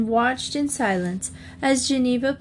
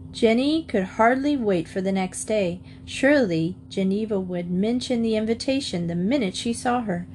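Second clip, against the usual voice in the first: ordinary delivery, nothing marked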